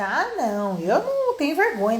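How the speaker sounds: normal talking voice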